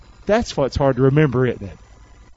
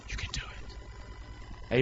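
very soft, slow whisper; whispered encouragement; 18 dB quieter, much slower than usual. Acted. Preacher answers the scared one, whispered encouragement. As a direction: whisper reassurance, drawing it out. Hushed encouragement after loud run.

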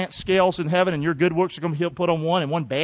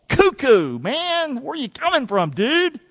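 mock bewildered; 7 semitones higher, slower than usual. Mocking Solomon's odd sayings.

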